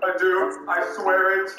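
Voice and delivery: loud oath. Acted. Skit oath declared loudly.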